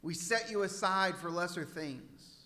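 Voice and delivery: soft, low, earnest prayer. Real, not acted. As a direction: pray gently, letting each phrase settle. Leads a closing prayer.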